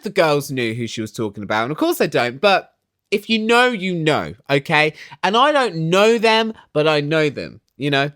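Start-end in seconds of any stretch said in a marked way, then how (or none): none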